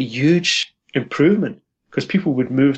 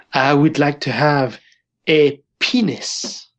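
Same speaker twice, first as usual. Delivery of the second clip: put-on a light, slow French accent on the line; slow French-accented Madame de Gaulle, only lightly put on; slower than usual. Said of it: "in her French accent".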